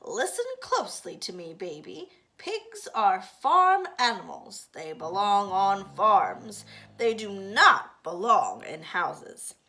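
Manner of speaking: loud, slow lecturing spinster; bossy sister laying down the rules; stern older sister. Acted. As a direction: lay down the law slowly and loudly. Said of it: "Eugenia often says".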